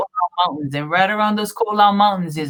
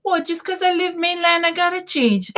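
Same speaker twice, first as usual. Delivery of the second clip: higher, sassy comeback; sassy retort; 9 semitones higher than usual. Retold or imitated. Retorting to relatives, higher and sassy. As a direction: answer back with attitude. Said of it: "And I said".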